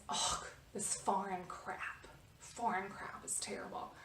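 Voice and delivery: slow, low-voiced scornful mutter; muttered disgust. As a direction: imitate the man sneering under his breath. Rude man's remark, retold.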